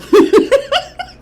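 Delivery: loud, high burst of laughter; real laughing fit. Real, not acted. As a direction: crack up at your own mishap. Laughing at her own mishap.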